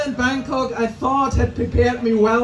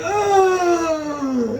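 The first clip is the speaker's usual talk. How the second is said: bending-over groan; 5 semitones higher than usual. Retold or imitated. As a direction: groan like your back gives out. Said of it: "I make noises now when I bend over".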